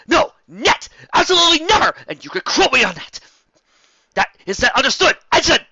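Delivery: high, loud, frantic refusal; panicked captain yelling no at his ensign; desperate scream. A narrator's reading. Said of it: "Kirk screamed, sounding desperate".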